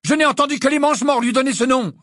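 high, loud challenging question; defiant teenage Harry. A narrator's reading. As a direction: throw the question back at the teacher.

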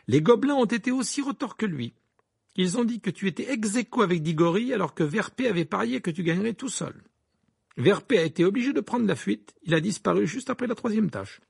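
a touch of animated grievance; aggrieved twin. Acted. Twin complaining to friends about an unpaid bet, lightly voiced indignation.

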